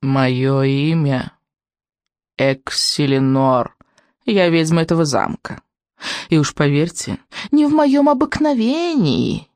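slow, drawn-out witch voice, lightly crackling; a hint of frosty crackle, stretching the words. A narrator's reading. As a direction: voice an old witch slowly and deliberately. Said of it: "продолжала она трескучим, как мороз, голосом".

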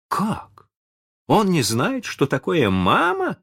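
put-on very high indignant girl's outcry; astonished cry. A narrator's reading. Wendy is shocked by Smee's ignorance, crying out. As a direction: cry out in disbelief, high-pitched. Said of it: "вскричала она".

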